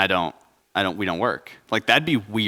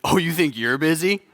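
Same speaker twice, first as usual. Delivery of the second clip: higher, mocking one-upping voice; competitive scoff; 6 semitones higher than usual. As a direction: outdo them with a sneer. Competitive busy one-upmanship.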